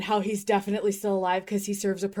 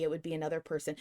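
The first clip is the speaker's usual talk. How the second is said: normal talking voice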